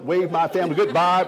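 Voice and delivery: usual speaking voice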